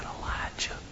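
hushed whisper, as God's still small voice. Acted. God to Elijah after the fire, whispering. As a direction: whisper the question. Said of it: "He starts whispering".

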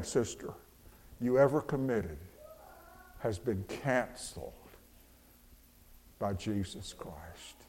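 tender reassurance. Real, not acted. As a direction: lean in and comfort her quietly. Preacher softly assures a listener.

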